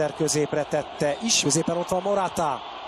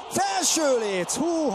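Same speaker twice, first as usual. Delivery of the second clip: slow high shout; excited near-miss call; 10 semitones higher, much slower than usual. Real, not acted. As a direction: draw out the shout in disbelief. Near miss hits the crossbar.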